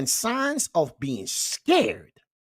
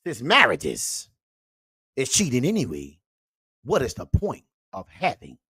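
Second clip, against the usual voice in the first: usual speaking voice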